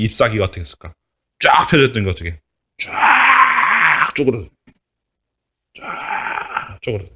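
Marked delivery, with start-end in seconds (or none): 2.8-4.5 high, slow, crying wail; dramatic. Acted. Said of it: "leaves shrivel, voiced". 5.7-7.1 softer, slow breathy whisper. Acted. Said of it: "echo whispered".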